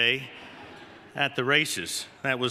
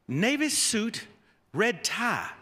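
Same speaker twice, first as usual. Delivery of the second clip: slow, higher Southern drawl, lightly put on; Coach Broyles drawl; 6 semitones higher, much slower than usual. Head coach telling new assistant what to wear, slow higher Southern drawl. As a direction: draw out navy suit like the old coach. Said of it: "In that sweet Southern drawl".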